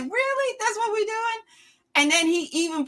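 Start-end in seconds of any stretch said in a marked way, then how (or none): 0.0-1.4 high disbelief. Retold or imitated. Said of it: "you're like".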